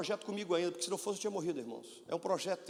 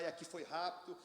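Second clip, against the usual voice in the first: normal talking voice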